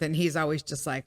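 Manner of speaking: normal talking voice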